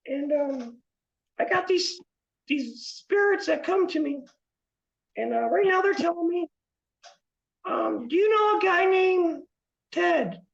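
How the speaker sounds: high, wavering spirit-reader voice; psychic medium voice. Pretend. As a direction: channel spirits like a stage psychic. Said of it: "I do readings".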